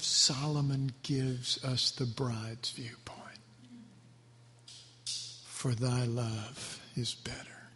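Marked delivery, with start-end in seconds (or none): 5.0-7.7 slow hushed whisper. Pretend. The bride whispering her love to him, slow. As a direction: breathe the line, slow and intimate.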